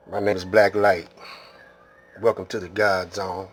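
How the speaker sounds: normal talking voice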